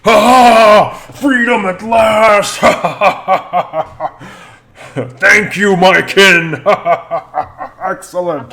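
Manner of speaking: huge high booming warrior voice, laughing; booming giant laugh. Acted. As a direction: bellow with triumphant belly laughs. Freed Goliath bellows and laughs.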